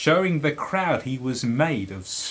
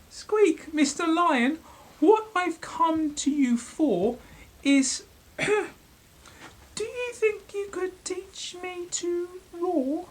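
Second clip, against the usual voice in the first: very high, squeaky polite mouse; tiny mouse waking the lion; timid mouse voice. Acted.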